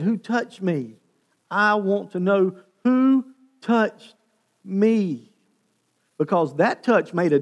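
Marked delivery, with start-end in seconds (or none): 0.0-5.3 loud, slow emphatic demand; raised voice, each word drawn out and weighted. Acted. Jesus halting the crowd to ask who touched him, demanding. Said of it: "And he said".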